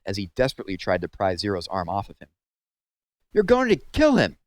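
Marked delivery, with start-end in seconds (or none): 3.3-4.4 very high, loud alarmed shout; panic, yell it across the yard. A narrator's reading. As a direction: counselor shouting as Zero chokes Zigzag. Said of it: "shouted Mister Pendanski".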